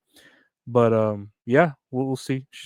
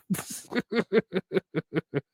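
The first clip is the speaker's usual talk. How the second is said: high, breathy giggle; 8 semitones higher, 6 dB quieter than usual. Real, not acted. Laughing at own joke.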